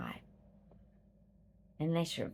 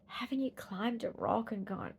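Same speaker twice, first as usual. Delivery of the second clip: ordinary delivery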